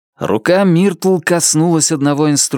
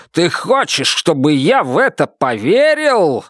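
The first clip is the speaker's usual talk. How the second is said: higher, mocking and bitter; sneering accusation; 8 semitones higher than usual. A narrator's reading. Buster's paranoid accusation.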